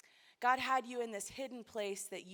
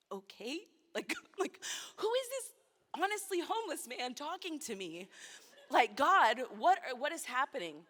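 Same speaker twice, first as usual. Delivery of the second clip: stunned disbelief; 3 semitones higher, 5 dB louder than usual. Retold or imitated. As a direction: pitch jumps higher in retold amazement, then louder. Retold stunned reaction to stranger.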